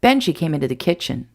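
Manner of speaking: usual speaking voice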